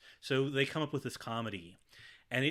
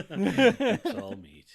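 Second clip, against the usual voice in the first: laughing echo; 9 semitones higher, 12 dB louder, much slower than usual. Real, not acted. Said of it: "repeats S0's joke".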